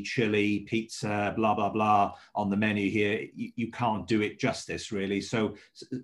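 usual speaking voice

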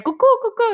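high squeaky bird call; mock cuckoo call. Sister signalling with a high cuckoo call.

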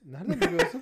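laughing. Real, not acted. Friend laughs, amazed the other guessed right. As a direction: laugh out the question, caught out. Caught out about hating actresses.

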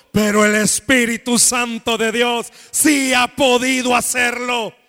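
loud, rousing sermon delivery; preaching at full force. Acted. Pastor rousing the congregation, preaching loud and full force. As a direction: preach it out to the whole church.

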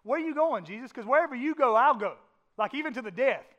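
an impulsive disciple swearing loyalty, loud; eager Peter. Retold or imitated. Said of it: "I can almost hear Peter say".

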